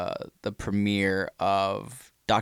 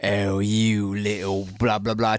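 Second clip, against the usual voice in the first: slow, lower mock British; one type of British accent; 3 semitones lower, slower than usual. Shown as a demonstration. Host showing how the accent switched, low mock British. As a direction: drawl out a badly faked British accent. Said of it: "four different types of British accents".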